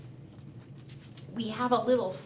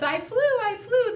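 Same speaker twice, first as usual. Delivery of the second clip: high thrilled cry; young bird squealing with pride; proud little bird; 9 semitones higher, 4 dB louder than usual. Acted. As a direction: shout it like a bird that just flew. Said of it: "when she says".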